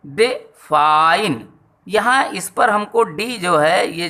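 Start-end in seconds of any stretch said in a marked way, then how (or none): 0.1-1.5 define with a short "de" and a long, leaning "fine"; stress on second syllable. Shown as a demonstration. Teacher demonstrating stress to pupils, define stretched on its second syllable. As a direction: draw out the end of define slowly. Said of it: "कम जोर लगाना पड़ रहा है".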